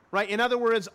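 ordinary talk, nothing marked

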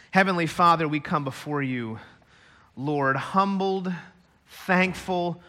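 soft, humble prayer voice. Acted. Pastor praying over the church, softly and humbly. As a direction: pray quietly before God.

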